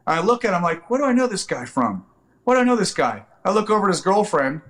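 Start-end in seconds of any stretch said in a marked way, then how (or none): 0.9-3.2 puzzled. Retold or imitated. Puzzled inner thought.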